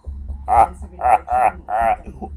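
loud silly laugh. Acted. Laughs amid mishap.

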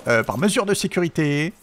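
normal talking voice

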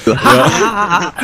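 high, loud burst of laughter; laughing. Real, not acted. Laughing at the insurance joke, high and loud. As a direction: crack up at the joke.

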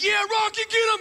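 high yelled cheer at the screen; cheering shout. Retold or imitated. Husband yelling at the TV, loud cheering. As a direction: scream for Rocky to get him.

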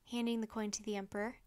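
usual speaking voice

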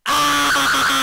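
high squeaky scream; helium scream. Shown as a demonstration. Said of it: "ヘリウムガス吸って叫んでみましょう".

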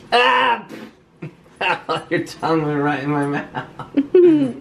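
high surprised laugh. Real, not acted. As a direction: laugh it out, startled. Dog licked his mouth.

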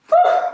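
high, loud grunt of effort; straining whew. Real, not acted. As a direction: push out the breath as you lift. Pushing weights overhead.

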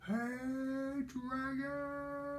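shout. Acted. Elizabeth shouting into the dragon's ear, high and long. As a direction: call it up high into his ear. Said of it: "shouted as loud as she could".

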